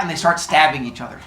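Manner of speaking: ordinary talk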